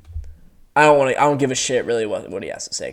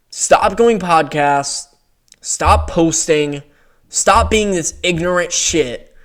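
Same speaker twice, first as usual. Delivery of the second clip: slow, deliberate; much slower than usual. Real, not acted. Ranting at Bo Nickel.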